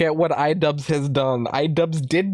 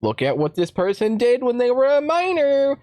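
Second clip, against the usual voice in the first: mocking cancel mob; 6 semitones higher than usual. Imitating cancel-culture crowd.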